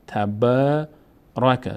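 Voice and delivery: تبارک said very slowly, aa held long; slow recitation. Shown as a demonstration. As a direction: draw out تبارک for the class.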